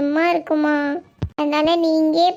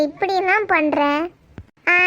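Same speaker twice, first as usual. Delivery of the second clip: ordinary talk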